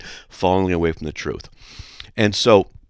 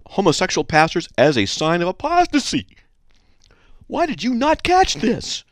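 high-pitched, scandalized complaint; mock-shocked, climbing higher as it goes; indignant listener; 8 semitones higher than usual. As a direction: read it as an outraged letter writer. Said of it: "this is what she said".

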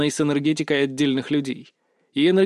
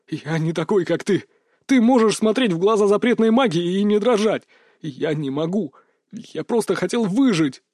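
fearful justification; 3 semitones higher than usual. A narrator's reading. Coward justifies himself.

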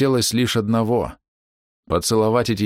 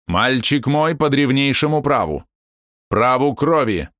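stern claim; 6 semitones higher, slower than usual. Acted. Grandmother claims her grandson.

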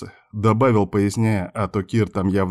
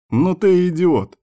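slow, higher amused disbelief; snorting; 9 semitones higher, 4 dB louder, slower than usual. A narrator's reading. Man reacting to friend's silly offer, scoffing. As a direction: scoff with drawn-out exasperation. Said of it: "фыркнул".

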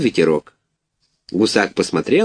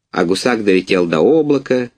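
ordinary delivery

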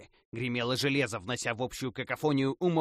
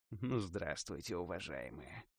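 slow, soft, low smiling greeting; 6 semitones lower, 10 dB quieter than usual. A narrator's reading. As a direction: greet them with a menacing smile. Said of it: "улыбнулся я".

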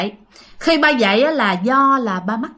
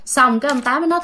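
ordinary delivery, nothing marked

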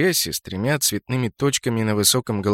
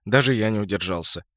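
ordinary delivery, nothing marked

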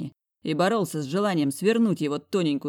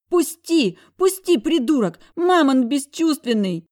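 put-on high, loud tearful scream of a struggling girl; girl sobbing and shrieking in his grip; sobbing outcry; 10 semitones higher, 7 dB louder than usual. A narrator's reading. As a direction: wail to be let go, crying. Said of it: "Прорыдало это недоразумение".

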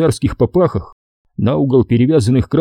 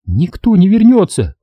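крик отчаяния; 9 semitones higher, 3 dB louder than usual. A narrator's reading. Девушка кричит Коле.

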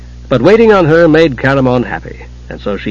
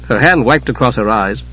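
usual speaking voice

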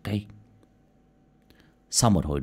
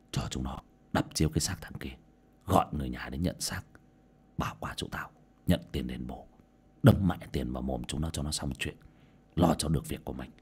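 much softer, partly whispered instructions; scheming quiet order; 7 semitones lower, 8 dB quieter than usual. A narrator's reading. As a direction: keep the cover-up hushed. Boss orders a cover-up. (thì thầm ra lệnh lén lút, nhỏ hơn hẳn)

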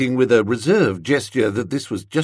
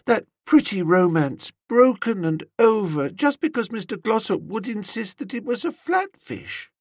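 wistful dreamy woman; 10 semitones higher than usual. A narrator's reading. Woman lamenting Angela's broken romance, wistful. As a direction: mourn a tragic romance, high and wistful.

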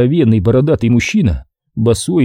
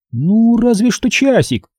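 slow, wavering agreement; hesitant; 10 semitones higher, slower than usual. A narrator's reading. Tatyana to the fishermen, slowly and hesitantly agreeing. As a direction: give in reluctantly, drawing it out. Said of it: "Неуверенно двинулась она к нам".

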